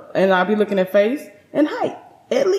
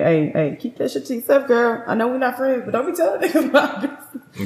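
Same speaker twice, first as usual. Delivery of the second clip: mock friend warning; 5 semitones higher than usual. Friend voiced warning her.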